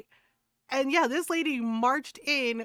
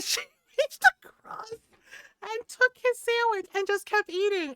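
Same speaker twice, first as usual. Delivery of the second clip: words pushed out through laughter; laughing while telling; 8 semitones higher than usual. Real, not acted. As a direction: crack up retelling it. Laughing at her own story.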